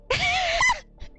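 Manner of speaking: high burst of laughter. Real, not acted. Teller cracks up mid-story.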